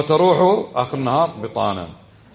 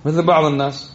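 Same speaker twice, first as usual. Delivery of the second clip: normal talking voice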